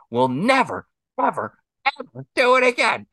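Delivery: high emphatic mock scolding; mock stern mom. Husband imitating wife scolding the niece, high and emphatic. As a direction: lay down the law, overdone. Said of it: "she goes".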